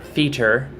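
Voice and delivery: "theater" as "THEE-ter", two syllables; rejected pronunciation. Shown as a demonstration. Showing viewers what not to say, "theater" as "thee-ter". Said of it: "Not theater".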